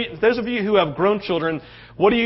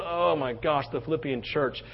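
fond memory; 4 semitones lower, 9 dB quieter than usual. Retold or imitated. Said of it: "just going".

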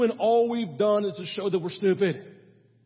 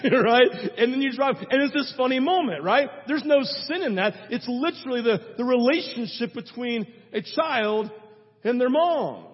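ordinary talk

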